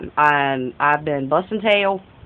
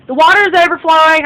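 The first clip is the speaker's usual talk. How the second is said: alarmed; 10 semitones higher, 8 dB louder, faster than usual. Real, not acted. Noticing sink overflowing.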